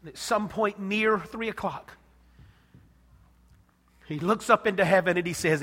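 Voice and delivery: ordinary delivery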